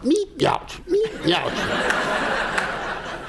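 very high squeaky meep and yelp; sharp yelp. Acted. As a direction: squeal like a flattened road runner. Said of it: "make a noise like a run-over road runner".

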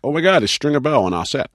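imitating crew excited about a celebrity; starstruck crew. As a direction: gush like a fan spotting a star. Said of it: "every day it's like".

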